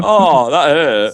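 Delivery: slow, hammy dying groan; milk a fake fatal wound; overacted death scene. Mock wounded by an insult.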